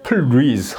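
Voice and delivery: "please" as peul-REEZ, weight on the second beat; wrong two-beat "please" with late stress; misplaced accent. Shown as a demonstration. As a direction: say "please" split, stressing the later syllable. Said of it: "위치가 틀린 거예요".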